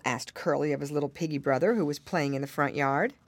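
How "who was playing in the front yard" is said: ordinary delivery, nothing marked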